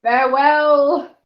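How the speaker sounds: a touch of a spooky villain; dark lord. Pretend. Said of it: "I don't know why I wanted to say farewell".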